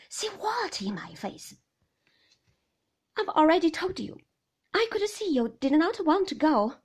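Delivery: fed up and overwrought; exasperated wife. A narrator's reading.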